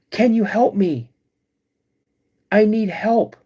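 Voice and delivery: high, loud pleading calls from the creature; creature calling out. Retold or imitated. Creature luring the hunter, calling out high and loud for help. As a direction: cry out for help like a hurt stranger, high and loud. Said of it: "and it goes".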